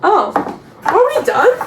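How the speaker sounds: ordinary delivery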